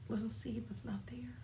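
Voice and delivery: hushed whispered refrain. A narrator's reading. Narrator whispering to the children. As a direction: drop to a secret whisper.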